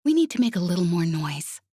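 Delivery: ordinary delivery, nothing marked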